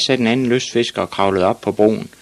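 ordinary delivery

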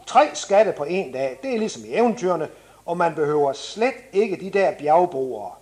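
ordinary talk

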